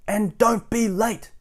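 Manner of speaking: high, slow, bossy order; bossy shout. Acted. Miss Bossy calling to Mister Brave, bossy shout. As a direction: boss him about down the phone. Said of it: "she shouted".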